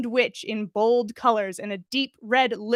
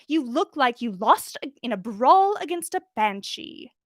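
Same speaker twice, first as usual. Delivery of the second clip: higher, louder haughty scold; exasperated prim scold; 3 semitones higher, 3 dB louder than usual. Acted. Umbridge scolding the server about her hair. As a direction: sneer down at the girl.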